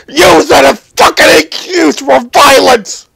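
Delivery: a real outburst, voice cracking with crying; furious crying shout. Real, not acted. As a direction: rage breaking into sobs at the cops. Furious rant about rioters.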